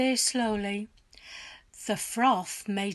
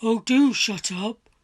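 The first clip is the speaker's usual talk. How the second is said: irritated husband; 7 dB louder than usual. A narrator's reading. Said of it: "Mister Twit said".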